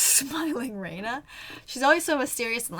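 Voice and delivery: ordinary delivery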